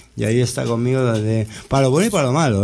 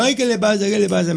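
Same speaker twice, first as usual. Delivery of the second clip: much higher, worried cry of a startled owner; retold alarm; 9 semitones higher than usual. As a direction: call out in alarm, half-asleep and scared for the dog. Woken at night by yelping dog. (el dueño asustado al oír al perro, voz alarmada)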